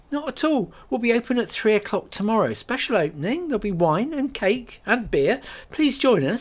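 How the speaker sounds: high, fast bright invitation; selling the opening; Kate voiced, eager. Kate eagerly invites the lady to the opening, fast and bright.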